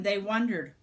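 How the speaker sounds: ordinary delivery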